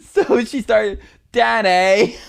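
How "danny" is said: loud, high Gump-style call, drawn out; Forrest Gump. Retold or imitated. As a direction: holler the name like drunk Forrest Gump. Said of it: "start talking like Forrest Gump".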